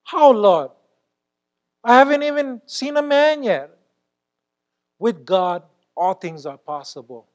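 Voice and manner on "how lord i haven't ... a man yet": Mary questioning. Acted. Mary answering the angel.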